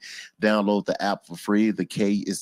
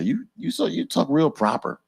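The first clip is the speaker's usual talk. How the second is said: mock New York voice, pitched higher; lightly voiced New Yorker teasing; a hint of a New York friend ribbing; imitating New Yorkers; 9 semitones higher than usual. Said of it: "they always say".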